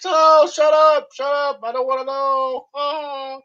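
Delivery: high, loud, drawn-out shriek of disgust; mock disgusted yell.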